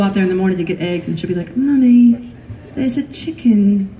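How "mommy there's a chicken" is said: put-on little girl's British accent, slowing down. Daughter telling mommy about a dead chicken, imitated British child accent. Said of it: "takes up some of that accent".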